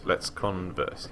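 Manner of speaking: slow, stretched let's converse; choosing to chat, slowly and teasingly; drawn-out repeat. Shown as a demonstration. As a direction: drag it out for fun. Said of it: "repeats slowly".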